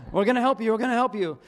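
calm him down by talking over him; a parent raising the voice to calm a screaming child; reassuring loudly. Retold or imitated. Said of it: "but, like".

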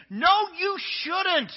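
very high, full-force yell; loud, high-pitched outburst at someone taking bad advice; exasperated scream. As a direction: scream it out in exasperation, drawing out the first words. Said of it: "I'm wanting to scream".

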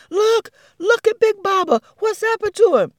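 high loud alarmed shout. Acted. Baby elephant shouting to the herd about Big Baba. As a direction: call out in alarm. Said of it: "Baby elephant began shouting".

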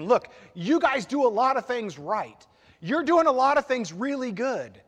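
emphatic praise. Acted. Paul voiced praising the church.

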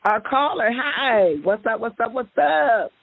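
ordinary talk, nothing marked

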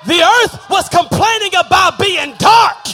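high, loud sung whoop over the music; preaching at full force. Real, not acted. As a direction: climax of the sermon, shouting it out.

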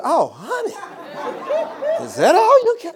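high, teasing "Oh, honey" ending in a laugh; mock retort. Mocking retort to the husband.

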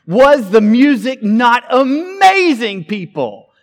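loud, high, slow hype-man call; imitating a preacher revving up thousands; hyping the crowd. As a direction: whip the crowd up like a stage host. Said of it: "he's addressing thousands of people and he says".

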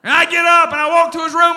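ordinary talk, nothing marked

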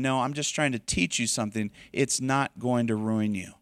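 put-on calm a scared child; a soft, quiet word of comfort; reassuring God voice. God answering a frightened believer.

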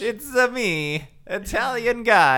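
put-on high, slow hammy Italian accent. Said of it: "do my Italian accent".

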